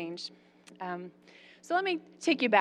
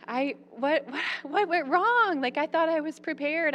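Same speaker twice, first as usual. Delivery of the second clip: distressed; 7 semitones higher than usual. Retold or imitated. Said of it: "recalling failure, distressed".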